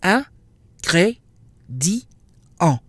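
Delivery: "in, gré, di, en" spelled out in syllables; four separate syllables. Shown as a demonstration. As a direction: break "ingrédient" into four beats. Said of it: "four syllables".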